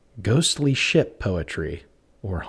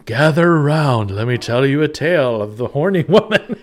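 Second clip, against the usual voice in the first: laughing storyteller pirate voice; 6 semitones higher, 5 dB louder than usual. Pretend. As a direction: spin a sea tale, pirate-style. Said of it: "Now my pirate voice is coming".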